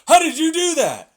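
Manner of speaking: high, loud shocked cry; mock shock. Mock bystander startled by a vanishing trick, loud and high. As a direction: yelp it out in fake amazement.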